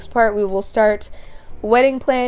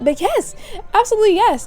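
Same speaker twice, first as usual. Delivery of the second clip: high, emphatic acted yes; excited acceptance; 12 semitones higher than usual. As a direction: play a thrilled girlfriend saying yes. Acts girlfriend accepting the proposal.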